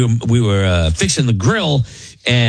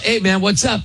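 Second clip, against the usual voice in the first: casual greeting; 7 semitones higher than usual. Retold or imitated. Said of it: "I'm like".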